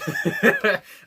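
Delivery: short high burst of laughter; amused laugh. Real, not acted. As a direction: laugh out loud at the line. Laughs at the lyric.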